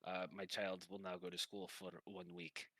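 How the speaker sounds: put-on effusive, measured Russian accent; Russian guy. Masseur thanks Pilfer for big tip, Russian accent. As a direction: gush gratefully in a Russian accent. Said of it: "And the one goes".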